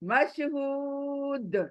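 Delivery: मशहूद slow with a bounced दाल at the end; qalqala stop. Shown as a demonstration. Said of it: "कलकला मतलब हिलाना".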